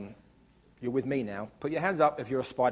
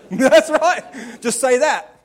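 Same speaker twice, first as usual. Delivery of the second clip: laughing emphatic; 10 semitones higher, 12 dB louder, much slower than usual. Real, not acted. Laughing and loud.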